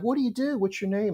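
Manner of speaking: put-on a high, sweet old-lady voice; lightly voiced kindly grandmother. The restaurant owner quizzes a newcomer in a high sweet voice.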